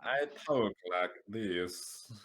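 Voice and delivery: slow, drawn-out mock voice; a slow, hammed-up voice, teasing about the accent; mock put-on voice.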